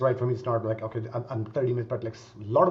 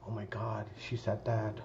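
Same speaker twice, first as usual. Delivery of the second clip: anxious inner voice; 5 semitones lower, 11 dB quieter, slower than usual. Retold or imitated. Voicing anxious inner thoughts.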